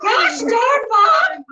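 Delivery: a hint of Southern drawl, laughing; mock Southern accent. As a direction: slip jokingly into a Southern accent. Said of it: "Now I'm starting to have a southern accent".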